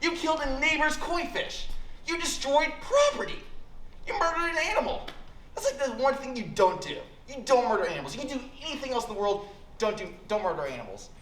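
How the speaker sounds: a hammed-up angry mom lecture; mother yelling. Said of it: "yelled at me hard".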